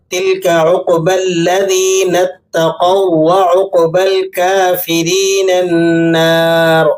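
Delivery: slow melodic tilawat; Quran recitation. Shown as a demonstration. Teacher recites the verse, slow melodic recitation. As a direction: chant the verse slowly.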